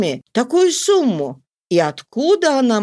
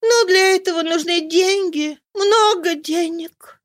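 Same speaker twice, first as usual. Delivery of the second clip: much higher, sighing woman's voice; sighing young woman; 10 semitones higher than usual. A narrator's reading. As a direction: sigh it as a worried young woman. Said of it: "вздохнула Роза".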